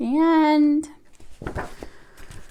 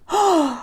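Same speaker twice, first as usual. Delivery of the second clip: dramatic gasp; 5 semitones higher than usual. Acted. Reading to kids, a hammed-up gasp at the spaghetti headline. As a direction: gasp as if the town is in danger. Dramatic moment in read-aloud.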